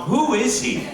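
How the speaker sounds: high loud demand; demanding kid. Retold or imitated. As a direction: blurt out who is he.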